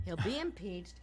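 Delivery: ordinary delivery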